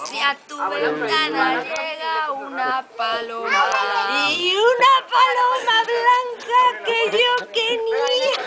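put-on drunk voice. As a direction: sing it like you've had too many. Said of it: "Un poco más de voz de borracho".

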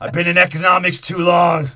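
shouting it out loud; a big loud yell. Retold or imitated. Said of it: "and he's like".

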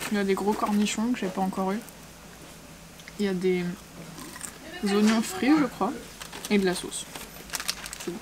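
eating a burger while speaking; talking while chewing. Real, not acted. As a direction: mouth partly full of food. Seen 0: Woman bites burger at -5; cheeks bulge, chewing while talking throughout.

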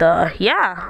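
usual speaking voice

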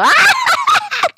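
squealing cackle way up high; sudden high screech of a laugh; high shrieking laugh. Real, not acted. Laughing at own joke.

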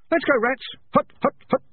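high, loud drill shout; marching call. A narrator's reading. Young rat leading the squads off, shouting.

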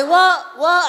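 loud, high, repeated query; mock incomprehension. Wife pretending not to understand husband, loud. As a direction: call it out as if you can't make him out.

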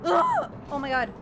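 ordinary talk, nothing marked

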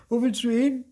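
high, loud called question; calling out. A narrator's reading. Marti asks where Luzi is going, calling out. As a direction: shout the question after them. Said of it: "rief Marti".

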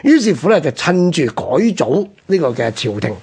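ordinary delivery

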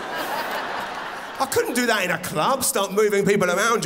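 normal talking voice

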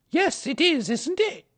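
sing-song motherly greeting; Kanga voice. A narrator's reading. Kanga greets Pooh, very high and motherly.

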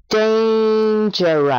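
drawn-out Dangerous for repeating; slow model word. Shown as a demonstration. Teacher asking the listener to repeat the sound, slow and clear. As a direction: say Dangerous slowly and clearly for the child to echo. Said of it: "y repite el sonido".

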